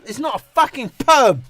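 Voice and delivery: loud irritated correction; annoyed outburst. Real, not acted. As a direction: snap back at the chat, fed up. Annoyed denial of perm.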